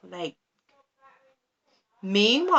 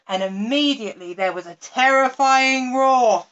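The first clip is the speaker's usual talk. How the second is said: ordinary delivery, nothing marked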